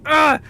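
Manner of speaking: loud, high mock groan of exasperation; exasperated groan. Friend fed up with constant apologies, loud exasperated groan.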